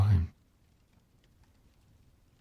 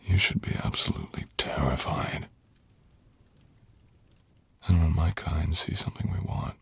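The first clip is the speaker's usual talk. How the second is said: menacing whisper. Acted. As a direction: hush it like a predator teasing prey. Dragon warns his bride, menacing.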